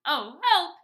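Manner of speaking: high, loud cry for help; acted frightened shout; panicked fox. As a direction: yelp it in storybook panic. Fox terrified by Gruffalo.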